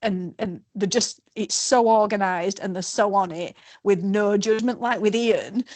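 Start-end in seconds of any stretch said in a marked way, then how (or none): none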